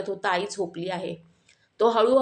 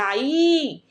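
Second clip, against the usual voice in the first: long, high, loud wail for mother; child's drawn-out scream, acted; loud cry out; 5 semitones higher, 5 dB louder than usual. A narrator's reading. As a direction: call out loudly, stretching the word high. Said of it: "Shivam जोरात ओरडला".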